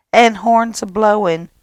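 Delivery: ordinary delivery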